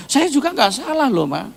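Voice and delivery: high, loud self-defence; mock protest. Acted reopened marital quarrel.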